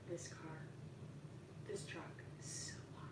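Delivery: hushed whisper from the corner. Real, not acted. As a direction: whisper it from across the room. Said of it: "Why are you whispering?".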